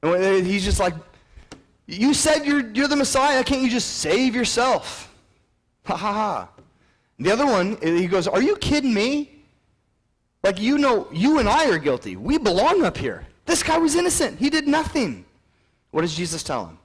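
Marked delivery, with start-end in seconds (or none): 1.9-5.1 high, loud jeer; mocking taunt. Criminal on the cross to Jesus, mocking. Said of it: "one of them starts mocking him". 5.8-6.5 high, put-on spoken ha-ha-ha; mock him with a fake laugh. Criminal on the cross jeering at Jesus, mocking. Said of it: "one of them starts mocking him". 8.3-15.2 high, loud, heated protest; incredulous rebuke. Acted. One criminal scolding the other, incredulous.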